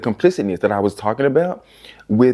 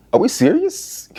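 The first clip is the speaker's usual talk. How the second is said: much higher, rising disbelief; incredulous squeak; 13 semitones higher than usual. Acted. Asking viewers about Gabe: high, incredulous rise. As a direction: shoot the pitch up in outrage.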